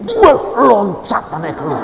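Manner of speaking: loud, high amazed cry; loud amazed exclamation; excited. Retold or imitated. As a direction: burst out with wonder. Climax of birth story.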